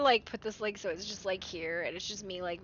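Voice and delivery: ordinary delivery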